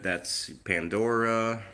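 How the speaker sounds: ordinary talk, nothing marked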